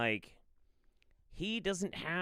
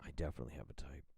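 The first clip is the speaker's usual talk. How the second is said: low, very soft whisper; whispered realisation; 12 semitones lower, 14 dB quieter, much faster than usual. Real, not acted. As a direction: whisper it quickly to yourself. Repeats it to self, whispered.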